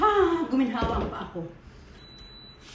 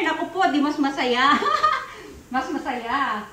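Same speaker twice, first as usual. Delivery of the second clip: talking through a happy laugh. Real, not acted. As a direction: giggle between the words. Laughs about many shares. (masayang tumatawa)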